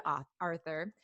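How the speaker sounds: normal talking voice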